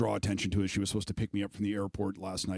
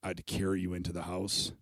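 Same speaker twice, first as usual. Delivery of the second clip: quiet, near tears, telling mom; tender teary memory; 5 semitones lower, 7 dB quieter than usual. Retold or imitated. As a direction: gently remind her, holding back tears. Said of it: "I was like".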